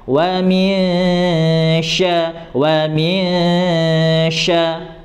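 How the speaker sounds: "wa min sya" with the n hummed long through the nose before sya; nasal ghunnah demo. Shown as a demonstration. Teacher demonstrating the nasal hum before sya, slow and held. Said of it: "didengung terlebih dahulu".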